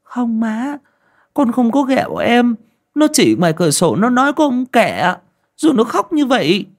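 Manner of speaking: put-on high, tearful child's voice; a scared boy defending himself close to tears; older son tells his mother, high and whimpering. A narrator's reading. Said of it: "thằng Thông mếu máo chỉ".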